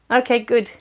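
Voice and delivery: ordinary talk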